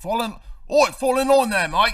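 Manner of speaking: very high, loud mock-Australian shout; Cockney slipping into Australian. Yelling at the rebel tooth in botched Australian. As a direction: holler it like a bad Aussie. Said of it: "that's Australian".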